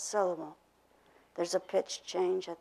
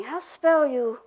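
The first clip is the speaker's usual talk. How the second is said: learner error said higher and louder; learner's wrong word order; 6 semitones higher, 6 dB louder, slower than usual. Retold or imitated. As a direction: quote the mistake brightly. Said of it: "When they are apt to be saying".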